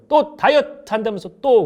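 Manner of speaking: put-on nagging older sister. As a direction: scold her like a fed-up big sister. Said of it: "그러잖아요".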